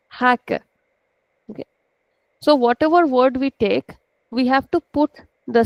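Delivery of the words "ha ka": long stressed Ha, light short ka; native Portuguese stress. Shown as a demonstration. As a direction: model "Ha, ka" with the first syllable stretched. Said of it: "how the native Portuguese language sounds".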